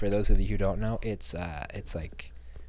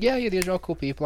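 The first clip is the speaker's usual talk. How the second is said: higher, breezy self-satisfied tone; higher mock-casual brag; smug mock reply; 8 semitones higher than usual. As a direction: play it cool and superior. Acting his own smug reply.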